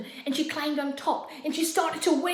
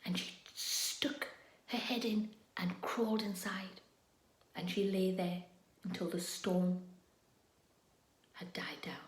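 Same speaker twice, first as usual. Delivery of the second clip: hushed narration; 6 semitones lower, 10 dB quieter than usual. A narrator's reading. Hiding from the storm.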